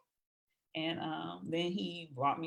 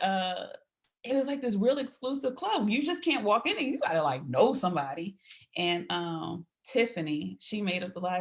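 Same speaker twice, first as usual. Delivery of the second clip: ordinary talk, nothing marked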